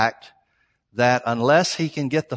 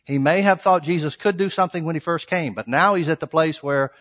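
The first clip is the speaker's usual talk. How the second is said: usual speaking voice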